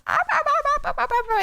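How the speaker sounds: high-pitched playful ah ah ah at the dog; high excited squeals. Retold or imitated. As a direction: squeal like calling a puppy. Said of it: "I'll be like".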